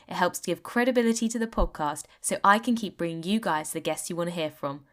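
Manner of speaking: smooth scripted host intro; podcast intro read. Acted. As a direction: read it like a friendly radio host opening the show.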